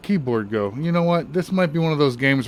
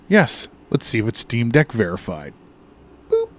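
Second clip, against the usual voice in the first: ordinary talk, nothing marked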